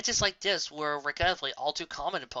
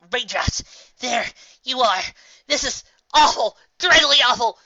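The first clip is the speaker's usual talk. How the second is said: distressed; 9 semitones higher, 11 dB louder than usual. Acted. Trixie rushes up in distress.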